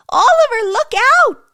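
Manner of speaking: high, loud warning cry; alarmed shout. Acted. Elf shouting to Oliver at the mixing bowl, high loud alarmed cry. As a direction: yell to stop him falling. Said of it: "one of the elves shouted".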